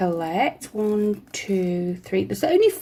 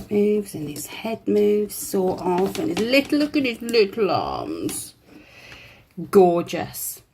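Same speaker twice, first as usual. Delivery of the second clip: ordinary talk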